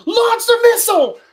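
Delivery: high, loud barked command; frantic shout to fire; shouted order. Retold or imitated. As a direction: yell the launch order. Kent orders missile launch.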